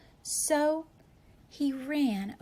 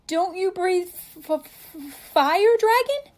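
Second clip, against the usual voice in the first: very high, stuttering challenge; stammering scared knight; 9 semitones higher, 9 dB louder than usual. Acted. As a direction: stammer bravely though terrified.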